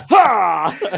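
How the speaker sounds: high, loud imitated "Ha!" into the ear; playful imitation of a sudden scare shout; mock startle yell. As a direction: yell it like sneaking up on him. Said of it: "He's like".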